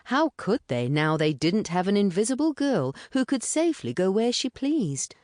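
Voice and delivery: plain reading voice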